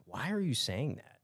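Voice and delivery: hushed, puzzled whisper; retold whisper. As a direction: whisper it like a baffled aside on air. Puzzled co-commentator retold, hushed aside.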